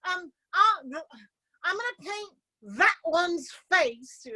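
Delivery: high, gushing mock Sherman voice; airy, self-important imitation of a classmate. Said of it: "She says".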